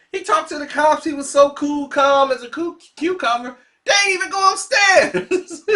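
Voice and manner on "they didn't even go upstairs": laughing shout. Real, not acted.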